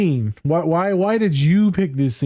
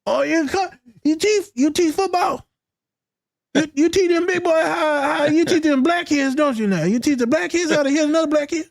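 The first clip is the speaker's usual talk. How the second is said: high, exaggerated patronizing voice; mocking voice of people; 13 semitones higher than usual. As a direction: ham up a clueless stranger, pitched way up. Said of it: "Everyone thinks I'm a coach".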